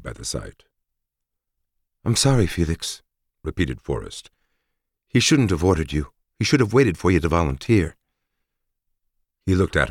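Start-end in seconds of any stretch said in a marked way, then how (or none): 2.0-3.0 female soldier voice. Acted. Narrator voices Forrest. 5.1-8.0 higher, earnest woman warrior; female soldier voice. Acted. Narrator voices Forrest.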